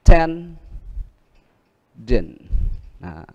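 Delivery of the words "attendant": attendant said loud, stress on TEN; teacher booming the example word; emphatic model word. Shown as a demonstration. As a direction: call out attendant with weight on TEN. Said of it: "thầy lấy một ví dụ nữa là".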